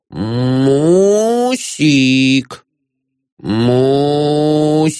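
long drawn-out musical hail; lilting, stretched-out summons; singsong call. A narrator's reading. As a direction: call out melodiously, stretching each vowel. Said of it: "певуче призывал".

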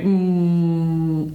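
usual speaking voice

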